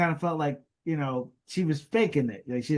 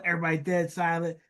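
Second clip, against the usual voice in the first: ordinary delivery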